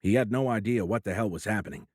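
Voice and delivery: usual speaking voice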